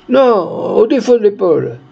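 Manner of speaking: high-pitched call of correction; objecting crowd voice. A narrator's reading. Bystander corrects another's aim, calling out high. Bystanders argue where to shoot.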